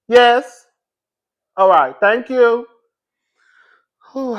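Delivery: normal talking voice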